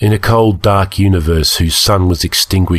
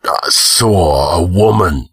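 slow, halting, dazed answer; hazy, slowed-down recollection; 3 semitones lower, slower than usual. A narrator's reading. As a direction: grope for the memory, dazed. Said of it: "Conan answered hazily".

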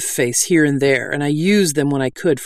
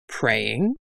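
low, softer rising question; curious query; 3 semitones lower, 5 dB quieter than usual. A narrator's reading. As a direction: echo the word with puzzled interest. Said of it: "Lomo called down the cliffside".

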